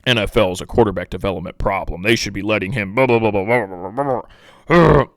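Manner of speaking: put-on pundit voice dissolving into blahs; dismissive parody of talking heads; mocking pundits. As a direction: imitate droning experts, running into nonsense babble. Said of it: "you had guys being like".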